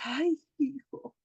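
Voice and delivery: slow, drawn-out sigh of dismay; mock dismay. Relatives facing a complicated family member, daunted. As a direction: draw it out as if daunted.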